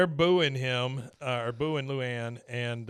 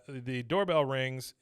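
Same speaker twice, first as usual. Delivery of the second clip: normal talking voice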